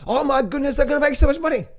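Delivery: hammed-up market euphoria, pitched high; thrilled crowd. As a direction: mimic the greedy crowd at the top. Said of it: "this time where it's like".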